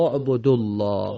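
اعبدو الله as obodollah, final vav dropped; recited phrase. Shown as a demonstration. Scene: teacher demonstrates the linked reading. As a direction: join اعبدو الله without the long u.

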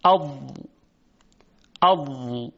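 اض with the ض pressed from the tongue's side against the upper molars; slow tajwid sounding of اض; Dad demo. Shown as a demonstration. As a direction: say اض twice, the ض firm and held.

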